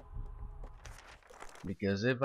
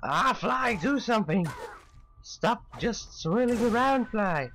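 yelling at Fly; 11 semitones higher than usual. Real, not acted. Urging his companion fly.

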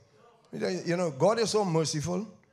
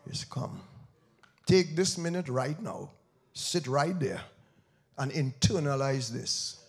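hushed preaching; 7 semitones lower, 6 dB quieter than usual. Acted. Preacher urges the church to face God's judgment, hushed. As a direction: draw them in close and quiet.

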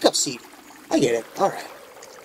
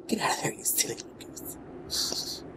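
slow whispered coaxing; 11 dB quieter, slower than usual. Real, not acted. Gamer coaxing little character out, slow whisper. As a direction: breathe it softly, slowly.

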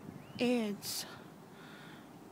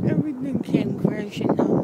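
Everything yelled much louder, full-voiced despair; anguished shout; 10 dB louder than usual. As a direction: bellow it out in heartbreak. Emotional rant, repeated line.

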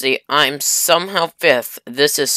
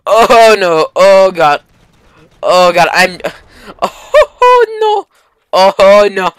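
loud, high panicked cries; yelling in alarm, half laughing; 5 semitones higher, 10 dB louder, slower than usual. Real, not acted. Nukes everywhere, panicking.

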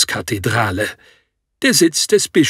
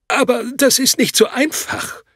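unsicher stotternd; 7 semitones higher than usual. A narrator's reading. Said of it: "stotterte ich unsicher".

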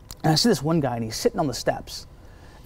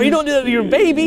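incredulous exclaim; 13 semitones higher, 6 dB louder, faster than usual. Retold or imitated. Mock scolding about the baby.

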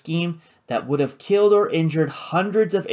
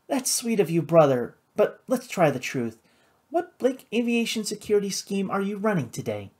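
lightly voiced, higher feminine challenge; sceptical sister; 6 semitones higher than usual. A narrator's reading. Flora doubting her brother's motive, sceptical.

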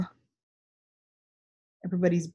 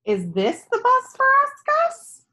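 put-on high, bright hopeful question, a child's voice; little girl hoping each vehicle is her bus; eager little girl; 6 dB louder than usual. Girl Tess asks in story.

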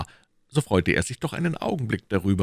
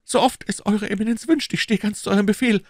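higher, louder nervous protest; anxious Bonacieux; 5 dB louder than usual. Acted. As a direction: plead his innocence. (ängstlicher Bonacieux)

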